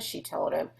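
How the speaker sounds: usual speaking voice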